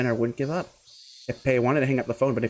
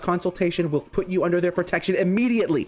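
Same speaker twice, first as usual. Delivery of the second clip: put-on higher, urgent boyish hero; eager Keiichi; 9 semitones higher than usual. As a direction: reassure her she'll be saved. Keiichi calls to rescue Satoko.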